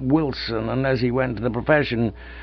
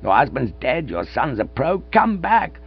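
pleading cry; 7 semitones higher than usual. Acted. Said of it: "he cried".

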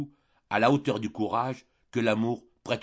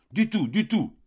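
high, raised group protest; crying out in protest; 9 semitones higher, 4 dB louder than usual. A narrator's reading. Boarders shouting down the landlady's plea, crying out. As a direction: cry it out indignantly, pitched well up. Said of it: "crièrent les pensionnaires".

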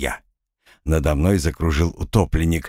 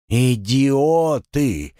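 long drawn-out, higher exasperated cry; 6 semitones higher, much slower than usual. A narrator's reading. Drowned spirit despairs at the men who threw him in, exasperated. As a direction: stretch the insult out in exasperation.

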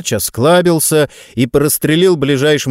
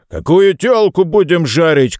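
higher, rowdy bellow; shouting; 6 semitones higher than usual. A narrator's reading. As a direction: call out to the whole table. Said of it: "крикнул грач". (громкий пьяный окрик)

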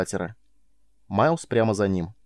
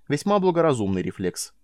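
ordinary delivery, nothing marked

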